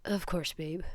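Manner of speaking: soft, gentle reassurance; tender reply. Acted. Hitoshi softly reassuring his exhausted boyfriend Denki. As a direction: answer softly, close and gentle.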